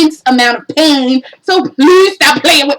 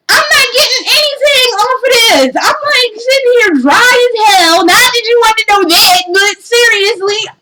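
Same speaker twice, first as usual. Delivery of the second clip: exasperated rant; 7 semitones higher than usual. Real, not acted. Ranting about VR ads.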